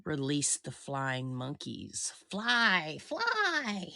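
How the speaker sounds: wicked witch calling her monkeys. Pretend. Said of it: "My pretty".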